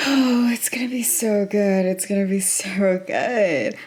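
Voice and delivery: slow breathy whisper of anticipation; excited whisper. Real, not acted. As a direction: whisper it like a secret thrill.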